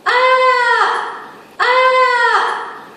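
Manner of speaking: high, loud scream sliding down like someone falling; loud high falling cry of someone dropping off a cliff. Shown as a demonstration. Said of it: "the sound, sorry, like".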